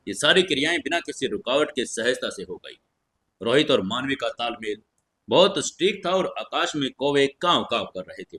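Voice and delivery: plain reading voice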